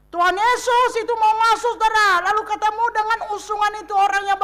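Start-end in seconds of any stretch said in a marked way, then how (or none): none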